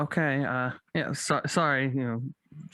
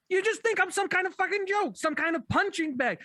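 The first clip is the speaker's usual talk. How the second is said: high-pitched, fed-up rant; indignant outburst; 7 semitones higher than usual. Acted. Insulted actor telling off the whole cast, indignant outburst. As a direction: throw the grievance at them, pitched high. Storming out of the read-through.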